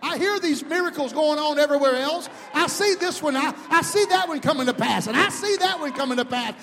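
high, very loud declaration of faith; full-force preaching. Real, not acted. Preacher declaring God will come through, full-force shouted preaching. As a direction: shout your faith out in driving, rising waves.

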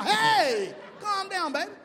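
high, loud "hey" then easing off; mock alarm, reining someone in; calling out to calm her. Husband telling his wife to calm down, high exclaiming.

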